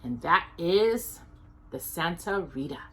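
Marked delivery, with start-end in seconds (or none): none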